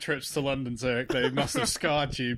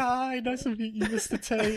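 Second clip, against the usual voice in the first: mock polite; 8 semitones higher than usual. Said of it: "He's just like".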